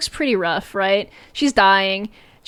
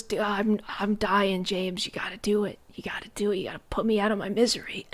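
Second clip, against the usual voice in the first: hammed-up whispered deathbed plea; much softer, airy whisper, imitating a dying woman; 8 dB quieter than usual. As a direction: beg in a feeble mock-dying whisper. Voicing dying wife begging for death.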